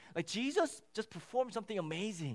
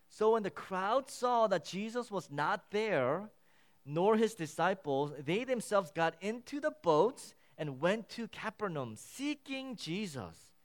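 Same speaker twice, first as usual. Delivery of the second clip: scripture reading; 4 semitones higher, 4 dB louder than usual. Acted. Preacher reading the Gospel aloud to his congregation, deliberate. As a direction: read the verses out with weight to the room.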